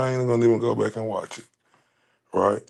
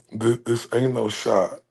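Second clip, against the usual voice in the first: ordinary delivery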